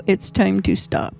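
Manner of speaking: ordinary talk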